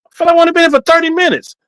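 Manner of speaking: high, disbelieving, rushing the words; surprised. Retold or imitated. Man retelling his shock at the strip club. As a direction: act stunned at how much time passed. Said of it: "I said".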